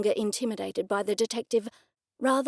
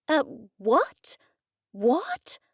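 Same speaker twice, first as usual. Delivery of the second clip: stammering; 3 semitones higher, much slower than usual. A narrator's reading. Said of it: "I stammered".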